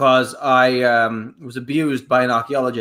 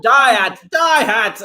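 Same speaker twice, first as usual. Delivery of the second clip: mock yell, higher and louder; screaming again; 9 semitones higher, 4 dB louder, slower than usual. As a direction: yell it like losing your cool. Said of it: "Yes, I'm so sorry".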